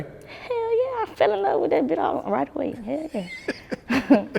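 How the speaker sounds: excited. Real, not acted. Gushing about loving music.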